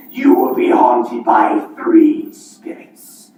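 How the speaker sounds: low booming ghost voice; a deep demanding spectre; playing both parts. Marley's grave prophecy.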